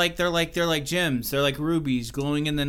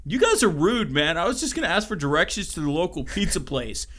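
put-on California accent; 2 semitones higher than usual. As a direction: play an offended California bro. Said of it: "he has a California accent".

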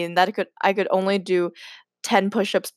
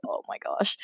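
whispered aside; 11 dB quieter than usual. Real, not acted. Reacting to her creepy story.

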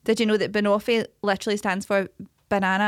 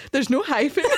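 higher mock quote, bursting into shrieks of laughter; laughing imitation; 12 semitones higher than usual. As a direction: mimic the friend while cracking up. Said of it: "and she went".

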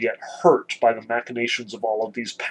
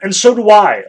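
put-on higher, louder boy's shout from the crowd; sudden call-out; 8 semitones higher, 11 dB louder than usual. A narrator's reading. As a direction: pipe up unexpectedly, calling out. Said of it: "suddenly and quite unexpectedly called out".

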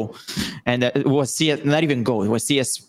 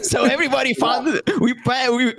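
laughing; 9 semitones higher than usual. Real, not acted. Laughing while telling story.

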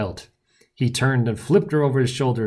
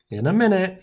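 mildly dismissive, raised pitch; 9 semitones higher, 3 dB louder than usual. A narrator's reading. Johnny busy fighting puts off the narrator, dismissive. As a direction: brush someone off while busy. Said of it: "said Johnny dismissively".

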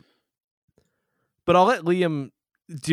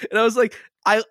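laughing; 5 semitones higher than usual. Real, not acted. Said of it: "laughing disbelief".

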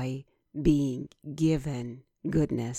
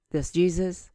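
normal talking voice

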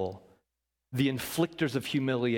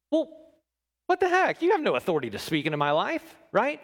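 indignant retort; 7 semitones higher, 3 dB louder, faster than usual. Acted. Acting an indignant listener.

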